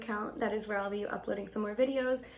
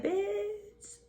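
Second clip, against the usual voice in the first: high-pitched drawn-out exclamation; Tanya; 11 semitones higher, 3 dB quieter than usual. Retold or imitated. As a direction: imitate a friend's sassy high call. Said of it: "You know what Tanya said?".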